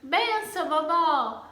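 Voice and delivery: lively calls asking a blessing; asking blessing with intonation. Shown as a demonstration. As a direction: call to mother, father, grandmother. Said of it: "Agora, se eu falo".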